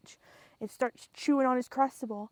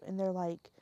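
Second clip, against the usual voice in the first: usual speaking voice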